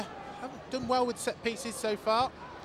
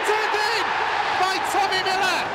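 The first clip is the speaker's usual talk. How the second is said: excited goal call; 5 semitones higher than usual. Real, not acted. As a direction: yell the scorer's name. Goal call in live commentary.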